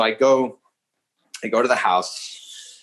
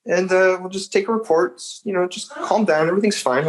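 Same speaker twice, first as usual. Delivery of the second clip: much higher, easygoing mock cop voice; chill cop; 10 semitones higher than usual. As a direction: imitate a laid-back officer soothing a suspect. Cop calming a nervous suspect.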